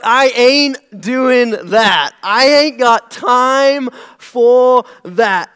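Southern woman flatly refusing; southern accent. Retold or imitated. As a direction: put on a Southern accent, slow and loud. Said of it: "that's my southerner".

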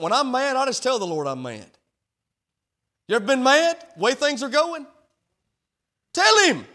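high, loud, driving preacher cadence; full-throated gospel sermon; preaching at full force. Acted. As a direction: preach it out to the whole church.

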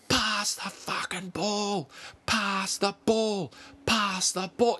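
high-pitched, drawn-out mock yell; imitated yelling. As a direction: stretch it out high, imitating a player calling for the ball. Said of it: "you saw him yelling out".

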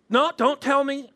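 high, loud mock protest; defiant refusal. Pastor mimics driver waving off help, mock defiant. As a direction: cut off advice like a stubborn driver.

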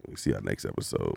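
very deep, soft sultry voice; sexy voice. Shown as a demonstration. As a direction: drop deep and hushed, seductive. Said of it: "perfect my sexy voice".

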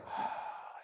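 soft voiceless sigh; demonstrated exhale. Shown as a demonstration. Teacher showing meditation students the exhale, soft sigh. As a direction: let the breath out in a soft sigh.

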